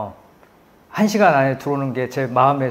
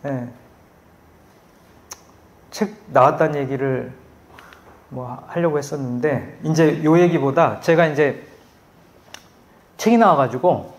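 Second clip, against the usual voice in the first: ordinary delivery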